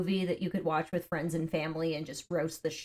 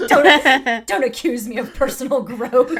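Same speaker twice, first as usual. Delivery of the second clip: loud, high protest through laughter; laughing while pushing back on the joke; giggling denial; 5 semitones higher, 13 dB louder than usual. Real, not acted.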